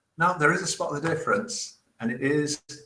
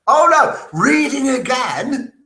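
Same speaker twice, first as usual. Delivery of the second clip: loud, high, slowed cry at own mistake; self-scolding exclamation; 9 semitones higher, 5 dB louder, slower than usual. Real, not acted. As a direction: exclaim it in mock dismay.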